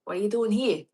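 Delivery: attempted Boston accent landing in New York. Shown as a demonstration. Australian trying Boston for viewers, New York accent. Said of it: "No, that's New York".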